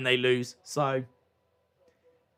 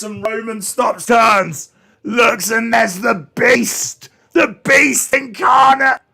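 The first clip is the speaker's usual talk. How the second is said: slow, high and loud, building the reveal; dramatic wrestling call, drawn out; hyped commentary; 6 semitones higher, 4 dB louder, slower than usual. Acted. Hyping Brock's arrival.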